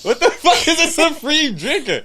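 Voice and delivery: high-pitched question through real laughter; incredulous laughing. Real, not acted. Partner scoffs at a supreme drinker claim, incredulous laughing. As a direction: crack up as you challenge the boast.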